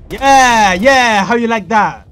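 loud, high triumphant yeah; gloating shout. Real, not acted. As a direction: crow over a beaten enemy. Gloating after a kill in game.

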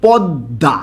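পদ্মা said as "pod-da"; everyday pronunciation. Shown as a demonstration. Teacher introduces a word to the class, said as pod-da. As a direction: say পদ্মা with a doubled d and no m sound. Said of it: "আমরা উচ্চারণ করি হচ্ছে".

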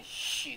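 "嘘" as a drawn-out sh sound. Shown as a demonstration. Teacher showing what CH says, "嘘" as a long sh. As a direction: hold the "sh" of "嘘". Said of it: "它要念嘘的声音".

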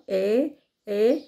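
ايه with the jaw dropped open; German Ä. Shown as a demonstration. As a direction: open the mouth wide for ايه. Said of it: "بتنزلوا بقكم كده".

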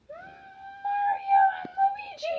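high, drawn-out announcing yell; loud yell. Pretend. Announcing the chosen pair to the others, loud yell. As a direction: stretch the names out high. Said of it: "you just yelled really loud".

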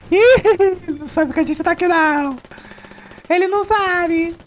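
high, sly sneaking voice; sneaky high voice. Pretend. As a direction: tease him like a sneaky kid. Sneaking behind unaware enemy.

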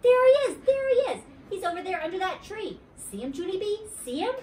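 high, excited calls, loud at the start. A narrator's reading. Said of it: "very excited".

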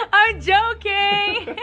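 slow, high, half-crying laugh. Real, not acted. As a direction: draw it out while laughing. Repeats through laughter.

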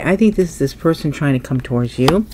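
ordinary talk

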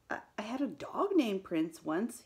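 a touch of weak self-defence; mildly lame, halting comeback; lame reply. A narrator's reading. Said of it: "he replied lamely".

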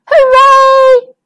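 loud, high group cheer. Acted. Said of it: "everyone cheered".

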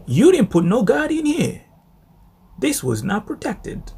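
high, loud rebuke; preaching shout. Real, not acted. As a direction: cry out the warning to the flock. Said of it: "preacher's climax".

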